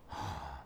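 soft, brief mock gasp of realization; imitated gasp. Co-host mimics the pastor's aha moment, soft mock gasp. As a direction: give a small quiet gasp, imitating his aha moment. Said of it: "and then he goes".

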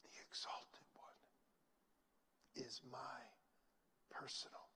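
very soft whispered prayer. Acted. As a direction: hush it to a whisper. Whispered altar call.

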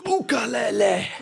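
high, ringing haka call; hammed-up funeral haka chant; imitated haka cry. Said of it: "And they're like".

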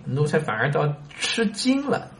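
ordinary talk, nothing marked

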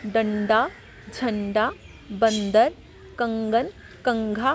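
डंडा, झंडा, बंदर, कंगन, कंघा each with a light half-n; half N sound. Shown as a demonstration. Teacher shows children the anusvar, light half-n in each word. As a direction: separate the words, a brief n inside each. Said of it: "हल्के से आधे ना की sound".

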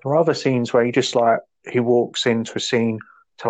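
ordinary delivery

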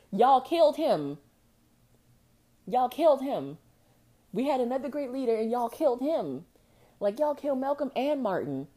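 high, loud, indignant accusation; real angry accusation. Real, not acted. Angrily accusing over killed leaders.

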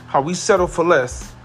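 ordinary talk, nothing marked

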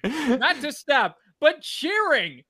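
slow, high and loud; loud, amused outrage; laughing disbelief. Real, not acted. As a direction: stretch each point through laughter. Laughing retelling of film's ending.

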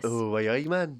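mock Geordie exclamation; put-on Geordie banter; Geordie accent. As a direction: join in with a playful Geordie accent. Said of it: "give us a Geordie".

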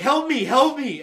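high, loud cry for help; acted-out frantic pleading; imitated panicked plea. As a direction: beg loudly as if desperate. Retells dying man begging for help.

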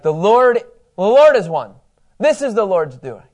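loud, slow banner-waving proclamation; proclaiming out loud. Pretend. Teacher acts out a banner declaring the Lord, loud proclamation. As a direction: call it out like a victory banner.

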